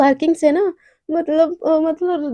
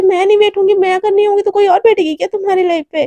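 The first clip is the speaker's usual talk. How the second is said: loud, higher jealous rant; jealous scolding; 5 semitones higher, 5 dB louder than usual. Acted. Jealous Devika scolding Radhika in the car, loud. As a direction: scold her possessively.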